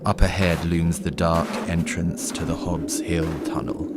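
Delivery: ordinary talk, nothing marked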